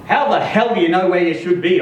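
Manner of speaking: ordinary delivery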